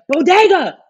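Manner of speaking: high, loud yelled BODEGA; hypothetical yell. Pretend. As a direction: yell it out like a frustrated aide. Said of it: "I would have been yelling".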